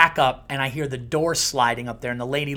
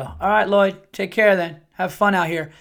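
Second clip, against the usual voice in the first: higher, louder breezy goodbye to the dog; fake indifference; 5 semitones higher, 5 dB louder than usual. Owner faking indifference to lure his dog, breezy. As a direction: pretend you don't care and walk off. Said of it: "And I go".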